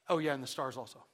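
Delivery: casual throwaway add-on; afterthought. Acted. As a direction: toss it off as if just remembered. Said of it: "an afterthought".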